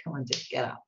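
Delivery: low, soft grumble to oneself; hushed, mock-weary self-coaxing; retold reluctant self-talk. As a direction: give in quietly, half under your breath. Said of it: "And I'm like".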